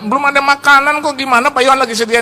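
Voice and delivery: high-pitched puzzled objection; mock baffled complaint, pitched high; retold protest. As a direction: act out bewildered guests protesting. Retold other guests protesting.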